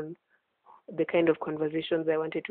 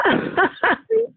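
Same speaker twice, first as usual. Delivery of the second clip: bursting into high laughter; big delighted laugh; 5 dB louder than usual. Real, not acted. Laughing after joke.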